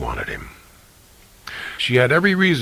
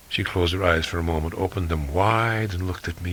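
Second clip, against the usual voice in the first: ordinary delivery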